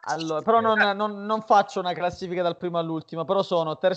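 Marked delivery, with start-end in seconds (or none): none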